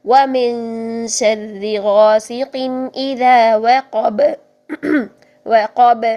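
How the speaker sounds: very slow, drawn-out Quran recitation. Shown as a demonstration. Teacher reciting Surah Falaq as an example. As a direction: recite it long, bouncing the final ق. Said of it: "با کی آواز کو بھی ہلا دیا".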